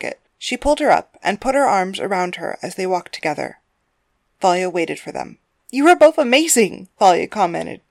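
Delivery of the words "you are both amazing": high, loud, gushing; bright girlfriend voice, pitched well up; delighted praise. Acted. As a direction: praise both fighters with glee. Girlfriend praises both fighters.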